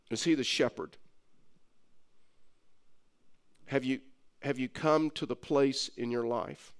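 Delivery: soft, low, gentle invitation to faith; tender altar call. Acted. Pastor inviting listeners to accept Christ, hushed and tender. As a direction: draw them in quietly, pastor to one listener.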